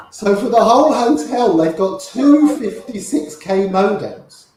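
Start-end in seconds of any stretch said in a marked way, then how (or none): none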